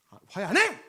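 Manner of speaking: loud, stubborn outburst; angry refusal. Retold or imitated. Pastor acting out an elder refusing to reconcile, loud and angry. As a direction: shout it like a grumpy elder refusing. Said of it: "이렇게 되는 거야".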